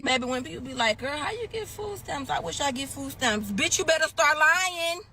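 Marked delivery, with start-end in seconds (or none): 1.0-3.5 higher, softer mimic voice; a light imitation of a jealous friend; mock envious friend. Replay of clip. 3.5-5.0 louder, higher punchline; sassy loud punchline; punchy advice. Acted. Replay punchline.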